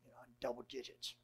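soft whisper, barely voiced; whispered retold thought. Student whispering his private goal to double digits. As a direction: confess the old ambition in a whisper. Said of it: "But I said".

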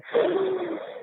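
a long, audible sigh out; deep sigh. Shown as a demonstration. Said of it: "take a deep breath".

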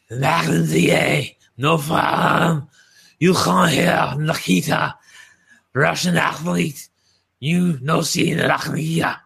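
lightly put-on Russian accent, slow and halting; a hint of Nikita Koloff's Russian accent, slowly. Wrestling podcast host doing a Russian promo, light Russian accent. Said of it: "You really sounded like a Russian".

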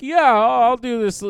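casual naive yes; mock Tyler Perry. Imitating Tyler Perry agreeing.

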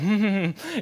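imitated closed-mouth snicker; stifled giggle. Grandsons watching their prank work, imitated stifled giggle.

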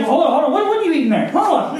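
high, sharp alarmed call; alarmed parent. Retold or imitated. Parent catching son eating charcoal, sudden high alarm.

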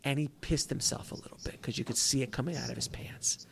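hushed, confiding whisper. Real, not acted. Telling the hosts the embarrassing part, whispered. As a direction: drop to a whisper for the gossip.

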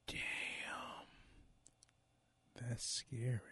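creepy unvoiced whisper. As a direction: hiss it like a ghost story. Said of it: "I got chills from that".

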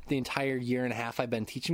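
ordinary talk, nothing marked

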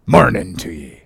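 lightly voiced odd stranger; Elijah's voice. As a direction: bid farewell as a cryptic prophet. Elijah's farewell, put-on voice.

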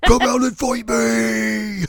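high, drawn-out challenge shout; mock battle cry. Host imitates the sword-swinging hero, shouting a challenge. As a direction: yell it out like a hammed-up cartoon fighter. Acting out character challenging foes.

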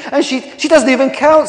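high, loud, stumbling objection; outraged rival piling in; indignant protest. Acted. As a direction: splutter in disbelief. Last rival joins the protest.

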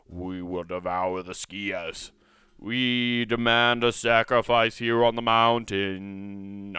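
slow, booming chant of a hungry tree spirit; evil tree voice. Retold or imitated. Trees on the mountain demanding skiers, slow booming chant. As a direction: intone it like a monster demanding a sacrifice. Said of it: "Trees are evil".

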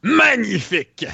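slow, high, drawn-out praise; excited praise. Real, not acted. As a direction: savour the word with delight. Praising a good roll.

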